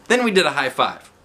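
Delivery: plain reading voice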